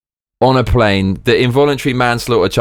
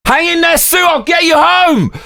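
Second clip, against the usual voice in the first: hammed-up heroic shout. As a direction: overblown action hero shout.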